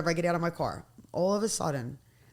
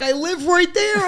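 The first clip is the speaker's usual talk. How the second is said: emphatic protest; 11 semitones higher, 7 dB louder, slower than usual. Retold or imitated. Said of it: "I'm like".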